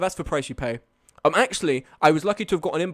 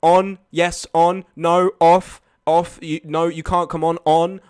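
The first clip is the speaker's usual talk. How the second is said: demanding bus driver; 9 dB louder, slower than usual. Retold or imitated. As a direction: boss everyone onto the bus. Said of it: "he was really demanding".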